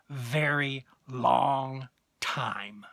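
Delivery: very slow, each word stretched; drawn-out words. Acted. Gardener waiting on tomatoes to ripen, long drawn-out emphasis. Drawing out the endless wait.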